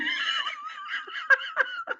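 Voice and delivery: very high shrieking laugh; squealing laugh. Real, not acted. Streamer squeals laughing at her own impression.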